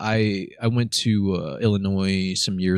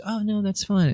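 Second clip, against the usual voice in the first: high-pitched imitation of a wife; airy, high mock politeness; buddy's wife; 12 semitones higher, 3 dB quieter than usual. Wife brushing off an apology, high imitated voice. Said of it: "And then she goes".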